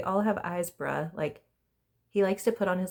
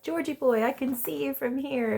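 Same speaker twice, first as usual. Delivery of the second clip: calling out; 8 semitones higher, 3 dB louder than usual. Retold or imitated. Villa calling out to George.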